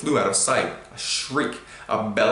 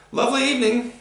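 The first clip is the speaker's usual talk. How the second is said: much higher, louder forced cheer; fake cheery shout; 4 dB louder, faster than usual. A narrator's reading. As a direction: shout a false friendly greeting. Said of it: "shouted Uncle Vernon".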